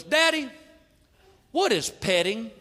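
put-on high, childlike question; kid voice. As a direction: play the curious little boy. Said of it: "my son goes".

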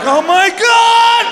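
high, loud, slow shriek; mock terror. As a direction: gasp in comic horror. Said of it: "I'm like".

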